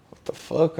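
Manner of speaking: soft, under-your-breath disbelief; puzzled aside. Retold or imitated. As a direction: say it quietly to yourself. Muttering his puzzled reaction to himself.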